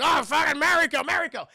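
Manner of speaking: high, loud mock panic; alarmed calling out. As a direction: call out for someone in danger. Voicing Logan calling after her.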